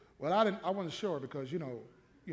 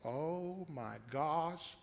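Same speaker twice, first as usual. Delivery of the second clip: ordinary delivery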